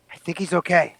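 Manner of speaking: lightly voiced relieved cleric; character voice. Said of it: "and I'm like".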